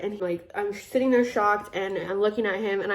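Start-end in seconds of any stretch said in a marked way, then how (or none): none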